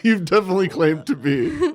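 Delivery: high, loud laughing tease. Real, not acted. Daughter teases her mom over claiming to be cool, laughing. Teasing parent, laughing through it.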